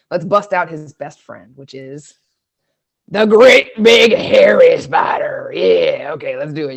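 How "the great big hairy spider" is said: loud gravelly growl; big gravelly monster growl. Shown as a demonstration. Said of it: "You gotta do a big gravelly voice like that".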